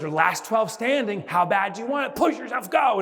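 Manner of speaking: high, urgent coach rallying survivors; hyped-up pep talk; acted motivational push. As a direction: urge the stragglers on, building in pitch. Researcher urging last runners on.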